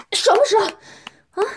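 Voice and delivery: high, loud demanding question. Acted. Dominant woman demanding her partner answer, loud. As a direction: push hard for an answer.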